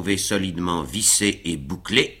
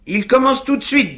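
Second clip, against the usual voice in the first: Sam urging; 4 dB louder than usual. A narrator's reading. Sam pushing master off.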